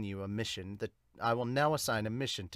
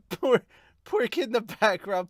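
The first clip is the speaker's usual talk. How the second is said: laughing through the words, higher and louder; 8 semitones higher, 9 dB louder, slower than usual. Real, not acted. Reader amused by a background girl, laughing.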